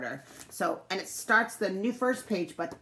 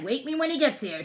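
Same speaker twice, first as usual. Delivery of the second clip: plain reading voice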